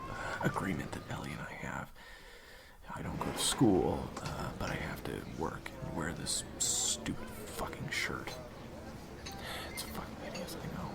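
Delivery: low, very soft whisper; hushed explanation. Acted. Waiter Zach explaining the deal with Ellie in a low whisper. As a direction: keep it barely audible.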